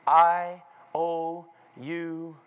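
very slow, spaced words; stretched-out, weighty announcement; deliberate emphasis. Shown as a demonstration. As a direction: draw out each word, then pause before "the gospel". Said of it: "watch me".

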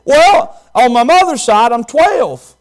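bigger, bolder retake. Acted. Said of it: "Say that again, boy".

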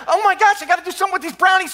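burning pan in hand, frantic. Retold or imitated. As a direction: rattle it off in alarm. Panicking over burning pan.

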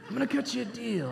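soft mock-mobster voice; mafia voice. Preacher jokingly repeats the steward's offer, soft mafia voice. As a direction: do a hushed, hammed-up mafia impression. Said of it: "It's exactly how they sound".

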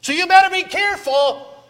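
loud, high, full-force pulpit preaching; fiery preaching. Acted. Preacher rousing his congregation, shouting at full force. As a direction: thunder it out to the congregation, building to a roar. Preaching warning about sin.

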